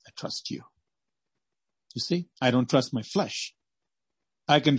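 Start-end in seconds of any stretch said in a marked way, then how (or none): 0.1-0.7 a hushed, devoted whisper; whispered prayer. Pretend. Preacher whispering a prayer of trust to the Lord. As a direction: breathe it as a whisper to God.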